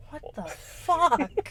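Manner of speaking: high, slow, drawn-out mock shock; stunned disbelief. Podcaster voicing Kirsty seeing the skinless monster, slow stunned shock. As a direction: stretch it out like you can't believe your eyes. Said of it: "And she's like".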